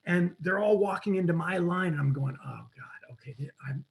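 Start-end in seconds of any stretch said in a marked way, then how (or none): 2.5-3.8 soft, sinking mutter to self; quietly deflated inner voice; retold dismay. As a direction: mutter it under your breath in dismay. Retold dismay at long line.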